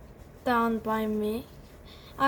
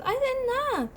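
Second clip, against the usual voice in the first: squeaky denial; 11 semitones higher than usual. Acted. Ant denying, high squeal.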